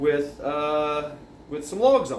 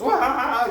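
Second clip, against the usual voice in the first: mock yelp; 11 semitones higher, 5 dB louder than usual.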